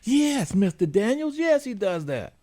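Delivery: high mock mother's voice, pitched well up; mimicking the mother. Mister Daniels quoting the caller mom, high pitched. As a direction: play the flustered mother, high and eager.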